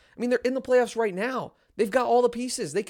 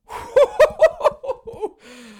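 loud, high burst of laughter; big laugh; 8 dB louder than usual. Real, not acted. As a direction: let out a whooping laugh.

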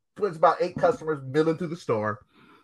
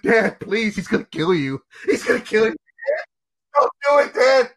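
a higher, pleading imitation of a crying boy; begging Dad, imitated lightly through tears; mimicked crying son; 3 semitones higher than usual. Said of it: "Literally crying".

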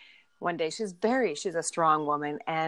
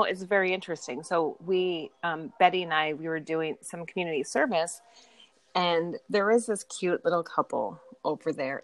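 normal talking voice

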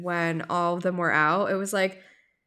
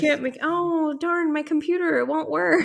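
higher, hammed-up fake apology; mock excuse; 8 semitones higher, 3 dB louder than usual. Co-host mocking an absent castmate's excuse, hammed-up and high. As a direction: whine about the broken computer, high and fake.

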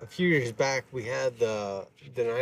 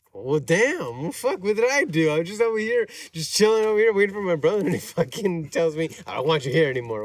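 high, louder indignant rant; a fired-up retold protest, pitched way up; mock outrage; 5 semitones higher, 4 dB louder than usual. Retelling how he snapped at the store owner, high indignant rant. Retelling his angry reply.

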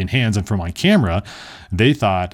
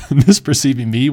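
louder, higher words broken by laughter; laughing; 5 semitones higher, 6 dB louder than usual. Real, not acted. Storyteller amused at his own mistake, laughing. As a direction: laugh while getting the words out.

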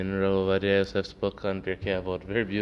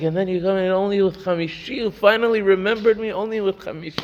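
much higher, louder outraged shouting; mock furious yell; 10 semitones higher, 5 dB louder than usual. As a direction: act out the insulted man losing his temper. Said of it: "he started yelling".

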